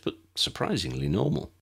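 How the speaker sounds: usual speaking voice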